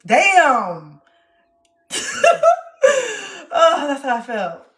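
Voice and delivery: loud laughing exclamation; burst of laughter. Real, not acted. Realizing how she felt, loud laughing outburst. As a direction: explode into laughter.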